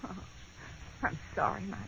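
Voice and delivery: soft breathy whisper of pity; whispered sympathy. Acted. Wife to jobless husband, whispered sorry. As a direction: barely breathe the apology.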